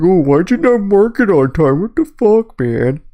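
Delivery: slow whiny complaint; mock angry gamer. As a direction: imitate a sore teammate. Imitating Marcus getting mad.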